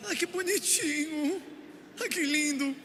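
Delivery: put-on high, slow cooing over a baby; doting dad. Preacher imitating a doting father cooing at his baby. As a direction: coo like a proud new father melting over nothing.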